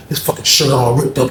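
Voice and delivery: normal talking voice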